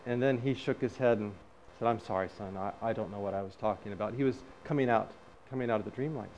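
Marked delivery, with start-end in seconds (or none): 1.9-4.1 low, soft retold apology; subdued apology. Father apologising to his son, low and subdued. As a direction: drop the voice, like a father coming to.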